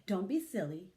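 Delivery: ordinary delivery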